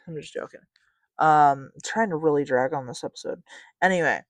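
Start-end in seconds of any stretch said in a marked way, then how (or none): 0.0-0.6 breathy whispered laugh, taking it back. Real, not acted. Taking back her grudge against the girl, a laughing whisper. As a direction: whisper it through a giggle. Laughing retraction of being mad.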